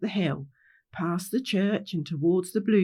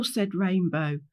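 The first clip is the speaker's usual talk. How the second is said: ordinary delivery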